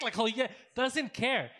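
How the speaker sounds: ordinary delivery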